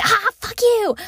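high, loud mock yell; angry outburst. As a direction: blurt it out in fury. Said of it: "I'm never like".

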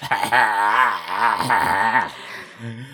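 hammy evil villain cackle; mustache twirling villain laugh. Acted. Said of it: "That's my villain laugh".

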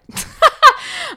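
loud high cackle; podcast laugh. Real, not acted. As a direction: burst into a shrill cackling laugh. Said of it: "I gotta stop laughing like that".

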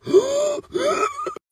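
high wheezing gasps for air; mock dying breaths, sucked in high; last gasps. Retelling running out of air, exaggerated last gasps. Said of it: "yo lanzaba mis últimos alientos".

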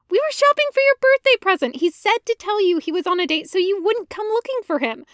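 very high, loud, fast rushed explanation; blurted Ahsoka. Acted. Ahsoka to Anakin, fast high loud rushed excuse. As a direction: blurt it all out at once.

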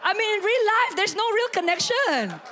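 high, loud, baffled amazement; exclaiming in disbelief. Real, not acted. Preacher asking what connects pin and childhood, loud incredulous exclaiming. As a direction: press the impossible coincidence on the crowd. Exclaims over impossible coincidence.

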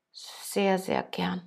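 put-on a crushed young man stumbles over his reply; barely voiced, halting yes; stammering. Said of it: "und stotterte".

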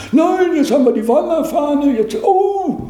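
high caricature of a party official; mocking the functionary. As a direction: parody the boss's excuse, high and put-on. Superior refuses to release him.

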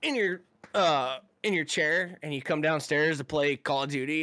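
normal talking voice

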